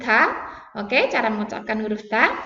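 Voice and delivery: normal talking voice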